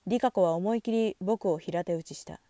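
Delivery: usual speaking voice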